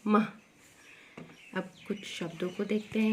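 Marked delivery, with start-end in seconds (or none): 0.1-0.3 मह short, ending in breathed h; visarga sound. Shown as a demonstration. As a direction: stop मह on a quick puff of h. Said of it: "म के बाद लगाएंगे तो क्या पढ़ेंगे".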